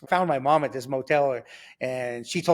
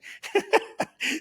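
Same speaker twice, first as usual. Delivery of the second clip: high chuckle; short burst of high laughter; real laugh; 12 semitones higher than usual. Real, not acted. Storyteller after his prison remark, laughing.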